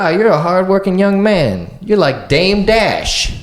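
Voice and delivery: high, slow mock old lady; a lightly voiced knowing old woman. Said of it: "She's like".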